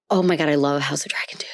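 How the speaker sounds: fast breathless whisper; whispered fangirl squeal; excited whisper. Real, not acted. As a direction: gush it under your breath.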